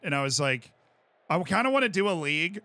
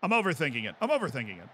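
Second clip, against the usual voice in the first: normal talking voice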